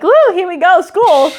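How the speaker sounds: put-on a six-year-old bouncing into school; mock excited first grader; excited kid. Kid cheerfully heading to school.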